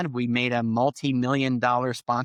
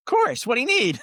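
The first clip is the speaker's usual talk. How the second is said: much higher-pitched, upbeat instant agreement; eager yes; 11 semitones higher, 4 dB louder than usual. Retold or imitated. Agency owner jumping at a client's request, eager. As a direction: re-enact an overeager can-do reply.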